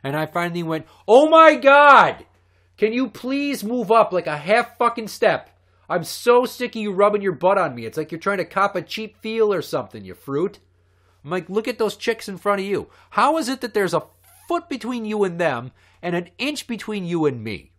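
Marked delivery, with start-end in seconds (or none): 1.1-10.6 a loud, high reenacted rant at a stranger; retold angry outburst. Retold outburst at rude concertgoer. 11.5-17.7 higher and a bit louder, indignant; retold angry outburst. Retold outburst continues at the guy.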